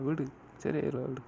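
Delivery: ordinary talk, nothing marked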